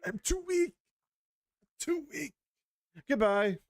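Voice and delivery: high, whiny sickly voice with a fake cough; mock feeble invalid. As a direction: ham up a dying weakling. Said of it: "Matthias is just all like".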